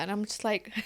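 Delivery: ordinary talk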